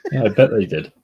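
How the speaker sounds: real chuckle breaking into the reply; amused laughing. Real, not acted. Co-host reacting to a hung-up warranty call, laughing.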